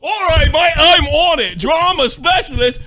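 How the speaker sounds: put-on high, loud shouted Australian accent. Playing the Aussie streamer on it, Australian accent. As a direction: bellow it like a cheerful Aussie mate. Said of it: "That was a really good accent".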